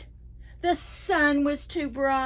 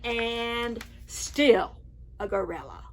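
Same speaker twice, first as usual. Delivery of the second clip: ordinary delivery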